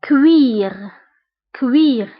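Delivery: كوير said as French cuir; teacher modelling كوير as French cuir; French word model. Shown as a demonstration. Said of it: "إكزامبل".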